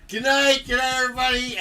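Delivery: high, loud retold goodnight; hurried loud sign-off. Nephew bailing on the film, loud high goodnight. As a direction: call it out high to the whole room. Retells fleeing the room, embarrassed.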